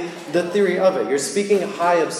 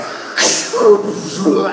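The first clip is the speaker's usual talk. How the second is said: mock crash cry; 5 semitones higher, 7 dB louder than usual. Imitating the crash sound.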